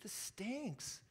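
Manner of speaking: soft, very drawn-out complaint; venting honestly. Acted. Preacher voicing an honest complaint to God, slow and soft. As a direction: let the grievance out slowly and quietly. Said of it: "in the moment, to just say".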